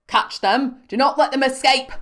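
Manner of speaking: loud, high command; shouting orders. A narrator's reading. Officer orders guards after fugitives, shouted. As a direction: bellow at the fleeing people. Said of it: "shouted again".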